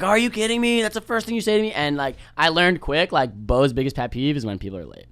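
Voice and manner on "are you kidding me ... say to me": high, loud incredulous yelling; mock furious rant. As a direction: tear into him like an angry coach. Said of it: "ripped Pat a new asshole".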